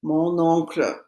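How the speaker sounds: "Mon oncle" with the N linked into "oncle"; linking N. Shown as a demonstration. Teacher repeats the rule's example, N pronounced in "Mon oncle". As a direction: sound the N of "Mon" before the vowel of "oncle". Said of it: "I have to pronounce the N".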